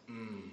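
low quiet approving hum; a deep murmured mm of approval; Baptist applause hum. Shown as a demonstration. Congregation approving a wise remark with a low hum. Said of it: "you go".

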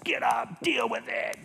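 high-pitched mock judge's command; harsh judge. Judge banging his gavel at sufferer, imitated harsh command. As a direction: imitate a judge ordering someone about. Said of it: "He's a judge and he's banging his gavel".

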